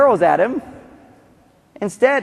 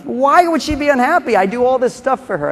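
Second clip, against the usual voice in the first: high, indignant puzzled husband; baffled husband; 7 semitones higher than usual. Retold or imitated. Husband about his unhappy wife, high baffled protest. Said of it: "the guy looks at his wife ... and goes".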